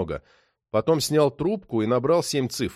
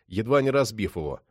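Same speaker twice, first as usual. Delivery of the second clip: plain reading voice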